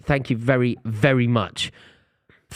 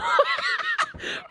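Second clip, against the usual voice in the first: helpless high laugh; can't stop laughing; high burst of laughter; 4 dB quieter than usual. Real, not acted. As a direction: crack up high and breathless. Laughing at his own impression.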